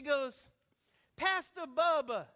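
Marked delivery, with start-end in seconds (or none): 1.2-2.3 high, loud, drawn-out call; excited calling out. Retold or imitated. Girl recognizes the pastor, calling out loudly. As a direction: imitate a girl hollering his name slowly.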